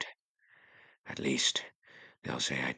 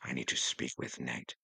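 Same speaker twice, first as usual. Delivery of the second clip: ordinary delivery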